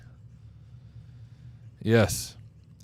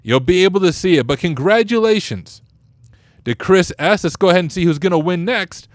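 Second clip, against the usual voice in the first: ordinary delivery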